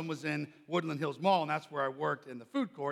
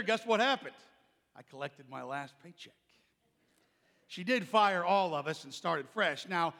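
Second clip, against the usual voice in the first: ordinary talk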